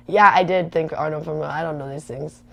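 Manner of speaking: ordinary delivery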